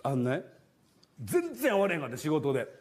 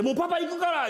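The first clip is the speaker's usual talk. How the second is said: calling out to daughter; 11 semitones higher than usual. Retold or imitated. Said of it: "言うて".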